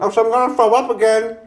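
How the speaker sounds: high, loud panicked warning; panicked plea. Real, not acted. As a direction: yelp it out while gagging. Gagging, pleading.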